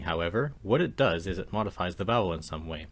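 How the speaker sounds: ordinary talk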